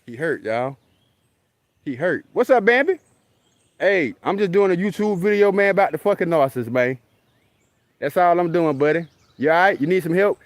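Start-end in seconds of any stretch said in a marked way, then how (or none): 2.3-10.4 higher, playful chat aimed at the deer; talking to the deer. Real, not acted. Vlogger calling out to a hurt deer, playful and coaxing. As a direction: coax the hurt buck like a buddy.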